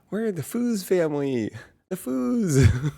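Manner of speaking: high, loud, giggling impression; imitating her excited, laughing voice; excited friend. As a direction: squeal it like a thrilled new friend. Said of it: "she's like, so excited".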